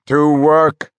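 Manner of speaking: rally the men back to work; cried out. A narrator's reading. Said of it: "cried Mark".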